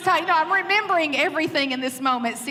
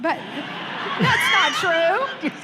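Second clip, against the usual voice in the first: 1 laughing protest; 2 semitones higher, slower than usual. Real, not acted. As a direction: protest through laughter, drawing it out.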